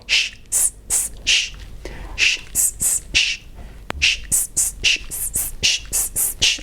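whispered, overdone "sh" and "s" alternation; exaggerated sh and s. Shown as a demonstration. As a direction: exaggerate every hiss and hush. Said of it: "Bien exagerado".

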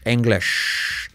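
"English" like "engleshhh"; "English" with e vowels and exaggerated hush ending. Shown as a demonstration. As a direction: overdo the long final sh of "English". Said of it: "Muy exagerado".

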